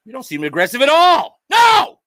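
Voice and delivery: fast, then a sudden loud high NO; mock aggressive yell. Roasting the sitter, he yells a mock denial. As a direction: snap back in fake outrage.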